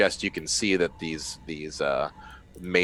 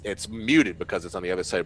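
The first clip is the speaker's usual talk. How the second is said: usual speaking voice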